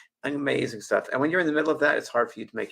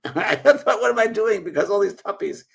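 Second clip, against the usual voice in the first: much higher, louder laughing disbelief; 10 semitones higher, 8 dB louder than usual. Real, not acted. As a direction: laugh at your own folly. Laughing at having puppies at sixty.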